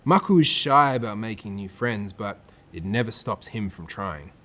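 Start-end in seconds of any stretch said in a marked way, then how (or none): none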